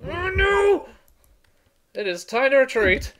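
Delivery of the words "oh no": high panicked cry; scared horror game streamer. Real, not acted. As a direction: squeal it in fright. Enemy chasing, scared.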